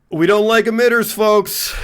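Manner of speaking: high, loud mock rally speech; Trump. Politician working up a bloodlusting crowd, loud impression. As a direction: rouse the crowd like a blustering politician. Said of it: "when he's like".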